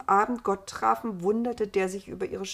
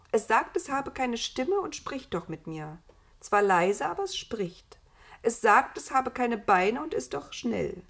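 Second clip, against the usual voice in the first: higher character voice for a woman; insistent Eva; 5 semitones higher, 4 dB louder than usual. Acted. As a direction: explain the puzzle in a raised, lively voice. Voices Eva describing the Nameless. (eindringliche, höhere Eva-Stimme)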